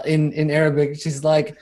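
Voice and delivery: ordinary talk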